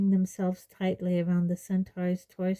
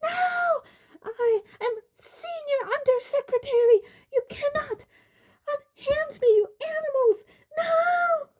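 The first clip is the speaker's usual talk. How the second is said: very high, hysterical screeching; shrieking protest; 3 dB louder than usual. A narrator's reading. Umbridge seized by centaurs, hysterical high shrieking. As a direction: shriek your rank in outraged panic. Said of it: "she heard Umbridge shriek".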